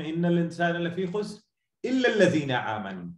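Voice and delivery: swift chanted Arabic verse; Quran recitation. Shown as a demonstration.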